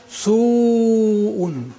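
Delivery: "su'un" as suuuu-un; lengthened vowel. Shown as a demonstration. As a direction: hold the u of "su'un" very long before the hamza. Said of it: "So the vowel is lengthened".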